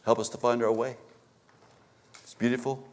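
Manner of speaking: usual speaking voice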